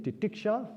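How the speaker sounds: "titiksha" repeated high with a rising end; teacher repeating "titiksha" for the audience; sounding out titiksha. Shown as a demonstration. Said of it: "And again".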